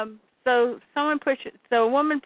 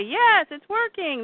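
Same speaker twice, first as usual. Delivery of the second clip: high, drawn-out cheer; excited cheer; 8 semitones higher, slower than usual. Acted. Fighters seeing their gunfire work, excited cheer.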